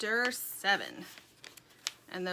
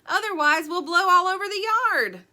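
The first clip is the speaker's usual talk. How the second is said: loud, high warning shout; yelling over storm; 9 semitones higher, 10 dB louder than usual. A narrator's reading. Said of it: "Sugar yelled over the storm".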